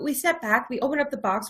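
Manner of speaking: normal talking voice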